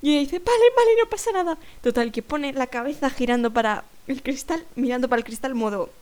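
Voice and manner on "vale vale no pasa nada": imitating flustered woman. Woman gives up on window.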